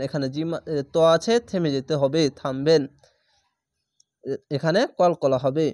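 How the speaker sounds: usual speaking voice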